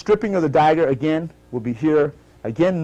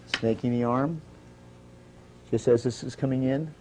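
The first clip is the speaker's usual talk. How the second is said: name each move softly as it happens; 2 semitones lower, 5 dB quieter than usual. Acted. Quiet slow-motion move call-outs.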